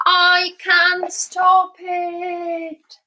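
very drawn-out high call; long, sustained high-pitched cry; calling from afar. Acted. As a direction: holler as you drift away. Wizard calls from drifting balloon.

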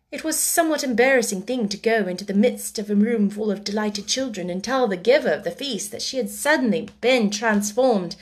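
usual speaking voice

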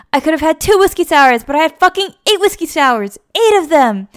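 high, loud ranting mock fury at himself; hammed-up angry rant; self-directed rage. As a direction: actor screaming at himself in trailer. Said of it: "screaming at himself".

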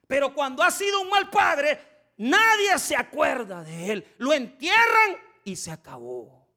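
full-force preacher's cry; preaching shout. Real, not acted. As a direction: shout the warning to the congregation. Preacher stressing the bad father.

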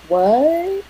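small, high rising mock question; stunned disbelief. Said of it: "she's like".

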